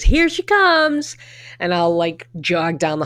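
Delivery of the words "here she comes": high, slow sing-song greeting; lilting high call as the owner greets you at the door; high drawn-out imitation of the owner's welcome; imitating the dog owner. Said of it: "and she always says".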